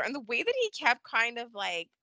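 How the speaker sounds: ordinary delivery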